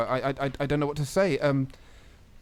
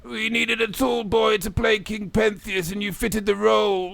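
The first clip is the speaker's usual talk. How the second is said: put-on addled old teacher; 4 semitones higher than usual. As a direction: drone it bluntly on a high note. Old teacher deflates pupil.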